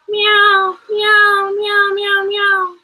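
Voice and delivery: high, insistent meowing; cat meows. Acted. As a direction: meow like a hungry cat begging for meat. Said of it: "start meowing".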